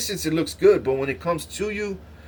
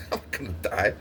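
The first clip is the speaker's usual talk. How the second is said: half-laughed, softer reassurance; laughing; 7 semitones lower, 8 dB quieter, faster than usual. Retold or imitated. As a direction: laugh it off through breath. Laughing at angels.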